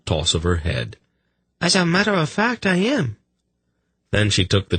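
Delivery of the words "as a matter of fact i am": very high, sweet female voice; high-pitched, politely innocent messenger; chirpy lady. Acted. Lady offering a drink to sufferers, sweetly chirpy. Voices Prala teasing the king.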